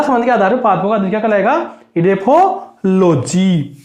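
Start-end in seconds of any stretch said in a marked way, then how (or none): 2.0-3.8 drawn-out answer word; slow dictation. Shown as a demonstration. Teacher to students, drawing the answer out slowly. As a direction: say it slowly so students can write it.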